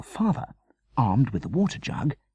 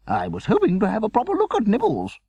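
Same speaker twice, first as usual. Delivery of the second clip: put-on fussy old lady; 5 dB louder than usual. A narrator's reading. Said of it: "looking through her glasses in disappointment".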